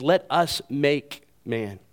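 "Let us make man" slowed, each word given weight; emphatic. Acted. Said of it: "it's emphatic".

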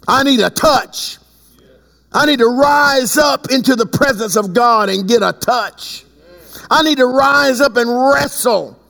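high, urgent preacher's cry; fervent preaching. Real, not acted. Preacher seeking a touch from God, fervent preaching.

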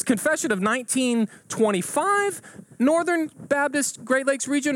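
hammed-up eager quizzing; excited questioning. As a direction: spell out each option with giddy suspense. Joke: last excited question.

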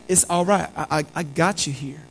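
soft, low and gentle reassurance; tender comfort. Acted. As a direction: hold him close with your voice. Jesus comforts Peter in pain.

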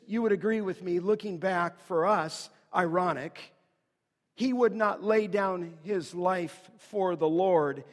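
ordinary talk, nothing marked

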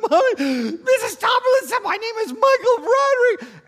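hammed-up, very high bawling; shrieking mock crying, words stretched into a wail; wailing child. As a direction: sob it loudly like a heartbroken kindergartner. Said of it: "to keep the tears back".

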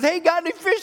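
high-pitched hail across water; calling from shore. Acted. Somebody on the shore hails the fishermen, calling out. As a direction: call out lightly to a distant boat.

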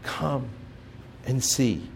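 hushed reverent. Shown as a demonstration. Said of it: "Every one of you heard".

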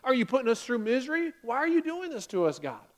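loud, disgusted complaint; hammed-up bitter-water protest; acted disgust. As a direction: spit it out and whine at God. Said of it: "the first person taking a sip of it going".

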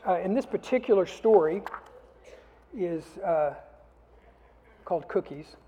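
put-on animated children's story reading. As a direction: read the story with big character voices for the kids.

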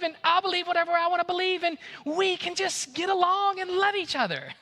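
sweetly patronize the officer with a big grin; sugary sarcastic voice, way up high; sarcastic sweetness. Pretend. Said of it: "Look at that police officer, say".

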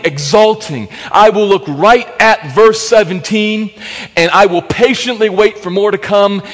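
loud, high, slow passionate preaching; rising sermon fervour; fervent preaching. Acted. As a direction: build it into a fervent proclamation.

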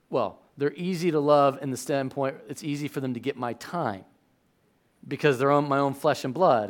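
usual speaking voice

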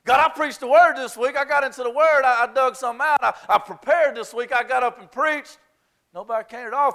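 loud, high, exasperated rant to God; self-mocking complaint. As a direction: vent at God like an overworked pastor, half-joking. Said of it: "let me tell you how mine looks".

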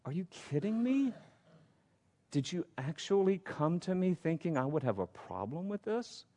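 disbelieving rhetorical question, pitched high; incredulous. Acted. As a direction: ask it as if they can't be serious. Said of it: "It's like he's saying".

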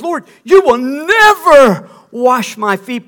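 a disciple crying out, slow and loud; Peter's loud protest. Acted. Peter refusing Jesus at the footwashing, a slow loud protest. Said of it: "You remember Peter said".

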